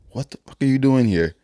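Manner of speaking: high-pitched mock confrontation; imitated intruder. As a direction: replay a stranger's indignant demand. Retold intruder confronting him.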